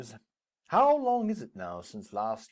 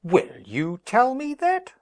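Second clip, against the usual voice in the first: indignant outburst; 13 semitones higher, 9 dB louder than usual. Acted. Capulet disputes cousin's count.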